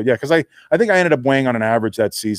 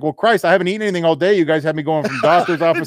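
fast flustered excuse; 7 semitones higher, faster than usual. Retold or imitated.

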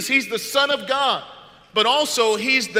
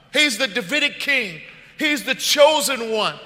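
louder and higher with each title; rising preaching; 5 semitones higher, 5 dB louder than usual. Acted. Pastor builds to a climax for the congregation, louder each line.

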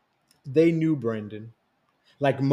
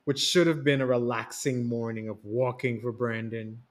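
plain reading voice

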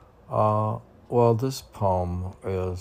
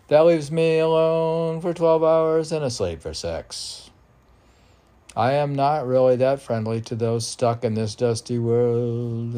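higher, louder declaimed verse; loud recitation; 5 semitones higher, 9 dB louder than usual. Acted. Poet declaiming his poem to the host, loud. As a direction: proclaim it.

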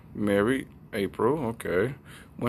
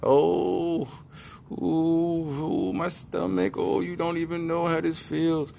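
high-pitched moaning complaint; hammed-up, high whine of pain; fussing wife; 8 semitones higher, slower than usual. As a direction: play a wife groaning over her stomach ache. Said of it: "She was fussing".

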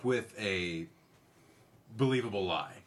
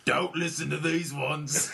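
put-on a giggly, higher Cockney accent, said slowly; 6 semitones higher, 4 dB louder, slower than usual. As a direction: play up a cute Cockney accent. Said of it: "an adorable Cockney accent that's coming from Nero".

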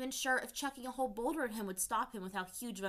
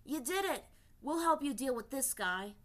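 excited; 6 semitones higher, 6 dB louder than usual. Acted. Teo cheering her victory.

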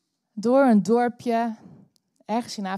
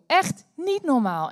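ordinary talk

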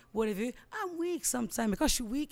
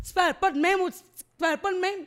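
mocking woman quote; 8 semitones higher, 6 dB louder, faster than usual. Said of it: "elle me disait".

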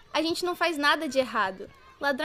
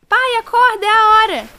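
urgent wake-up call; 7 semitones higher, 15 dB louder than usual. Acted. Daughter wakes father before dawn.

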